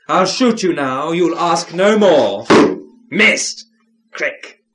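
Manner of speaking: loud, high melodramatic stage villain; mustache twirling villain. A narrator's reading. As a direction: ham up the villain's threat and curse. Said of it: "The villain hissed".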